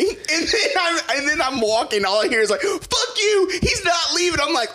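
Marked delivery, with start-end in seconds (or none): 2.6-4.4 high-pitched retold shout; mock yelling. Overheard mother-daughter fight as driver leaves, mock angry shout. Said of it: "heard them yelling on the balcony".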